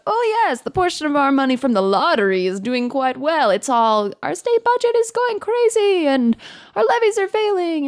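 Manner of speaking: high, chirpy official spin; sing-song fake optimism; mock official voice. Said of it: "nobody ever talks about".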